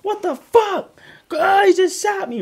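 high, shrieking panic, mocking the victims; frantic high-pitched mock wail; mock panicked screaming. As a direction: imitate them screaming for their lives. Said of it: "They on the ground crying like".

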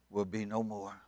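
ordinary talk

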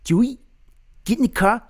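high, loud South African impression; hammed-up South African accent, way up high. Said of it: "Can you, can you do a South African?".

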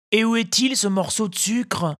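put-on high, eager puppet boy; eager child. A narrator's reading. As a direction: ask greedily for the sugar. Pinocchio asks eagerly. (voix d'enfant impatient)

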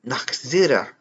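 German accent on a single word. Shown as a demonstration. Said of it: "Did I do a good German accent?".

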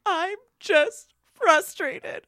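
high, loud, slow tearful wail; hammed-up sobbing frustration; mock crying complaint. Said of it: "And I was like".

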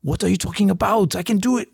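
hammed-up, high French-accented comeback; bad French accent. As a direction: protest in a badly faked French accent. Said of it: "you just cannot do a French accent".

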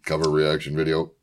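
flat mechanical robot voice. Acted. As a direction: speak like a stiff robot. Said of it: "This is my robot voice".

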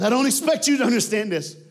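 high and loud, bursting with feeling; over the top. Retold or imitated. Grieving husband Gary addressing his wife's killer, high and loud. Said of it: "he's over the top".

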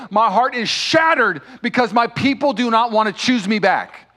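loud cry of heartbreak; preacher's heartbroken yell; anguished shout. Acted. Voicing God's shattered heart, shouting.

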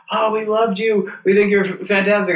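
high, gushing mock praise; gushing fan voice. Comedian mocking fans in a high gushing voice. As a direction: play the fawning fan after the show.

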